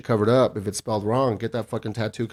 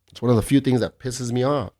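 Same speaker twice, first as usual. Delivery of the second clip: ordinary talk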